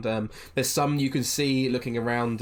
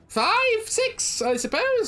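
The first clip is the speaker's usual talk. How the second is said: squeaky, much higher gnome voice; very high haggling gnome; slower than usual. Acted. Gnome vendor haggles over noodles, very high squeak.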